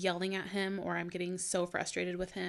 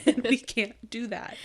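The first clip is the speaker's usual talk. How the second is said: louder, higher and slow, laughing through it; 4 semitones higher, 9 dB louder than usual. Real, not acted. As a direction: giggle while you scold. Laughs retelling the throat incident.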